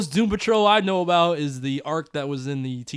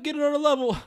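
high-pitched; 11 semitones higher than usual. Shown as a demonstration.

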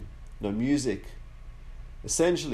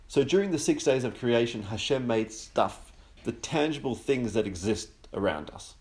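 ordinary talk, nothing marked

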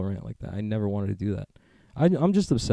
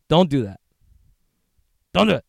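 mock scolding; 6 semitones higher, 8 dB louder, faster than usual. Scolding Biden.

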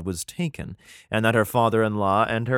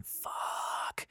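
hushed, whispered curse; whispered dismay; 15 dB quieter than usual. Acted. Reader reacts to the ark being taken, whispered dismay. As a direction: let it out as a long whisper.

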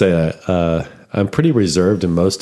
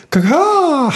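sneeze imitation; 5 dB louder than usual. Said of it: "sneezing just feels like".

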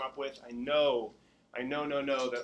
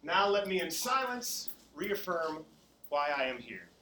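slow, loud chanted affirmation; 3 semitones higher, 4 dB louder than usual. Acted. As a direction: lead the group slowly. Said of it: "leading the students in affirmations".

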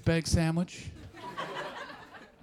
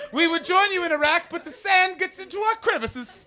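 mock diplomat excuse; 11 semitones higher than usual. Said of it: "That's how Canadians do diplomatic policy".

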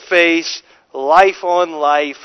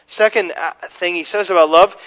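usual speaking voice

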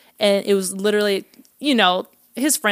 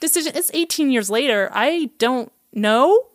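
nervous; 6 semitones higher than usual. Retold or imitated. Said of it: "I got really nervous".

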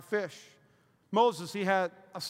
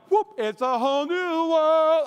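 high, drawn-out, loud marvelling; hammed-up awe, stretched out slowly; mock wonder; 7 semitones higher, 7 dB louder, slower than usual. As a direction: play up a child's amazed first look. Child seeing clearly first time.

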